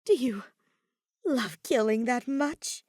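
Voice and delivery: plain reading voice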